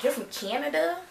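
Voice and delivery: high-pitched mock Indiana accent, questioning; imitated Indiana accent. Airport acquaintance asking where she's from, imitated Indiana accent. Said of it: "With her Indiana accent".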